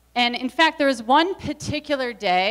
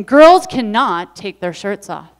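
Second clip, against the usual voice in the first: scolding mom; 9 dB louder than usual. Retold or imitated. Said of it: "and she's saying".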